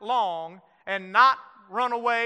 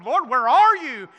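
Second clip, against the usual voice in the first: mock cry out; 3 semitones higher, 9 dB louder than usual. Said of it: "He cries out to the Lord".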